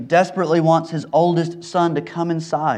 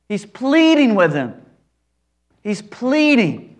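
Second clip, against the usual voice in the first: higher and pleading, stretched out on the last line; earnest emphasis; 6 semitones higher, slower than usual. Real, not acted. Preacher stressing the father's desperate pleading, higher and stretched. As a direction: lean hard into pleading. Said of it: "emphatic repetition".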